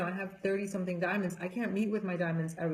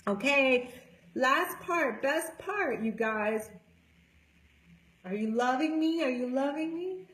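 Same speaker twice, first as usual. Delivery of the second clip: excited; 6 semitones higher than usual. Real, not acted. Hyping up the group.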